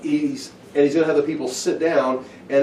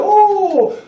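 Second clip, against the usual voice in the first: very high, loud, drawn-out oh; hammed-up ooh of an impressed audience; onlookers' awe; 7 dB louder than usual. Guests watching the wine appear, hammed-up awe. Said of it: "and we go".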